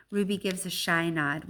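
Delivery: ordinary delivery, nothing marked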